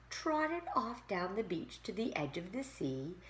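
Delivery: ordinary delivery, nothing marked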